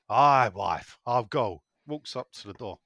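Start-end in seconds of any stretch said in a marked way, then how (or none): 0.1-1.6 low, loud Yorkshire husband; put-on Yorkshire bloke, pitched down. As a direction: agree bluntly as a comic Yorkshire husband. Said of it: "Yorkshire couple go on holiday to Spain".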